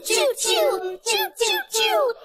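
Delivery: high sung choo choo; high train whistle choo. Pretend. Train noise imitation.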